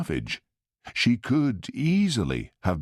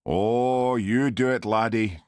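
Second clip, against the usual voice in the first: jeering; 4 semitones higher, slower than usual. Acted. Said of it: "jeered Sandy".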